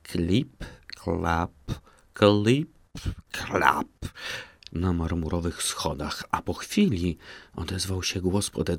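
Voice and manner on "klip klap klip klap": slapping footsteps. A narrator's reading. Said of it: "rozległo się nagle głośne".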